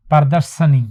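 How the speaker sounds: ordinary talk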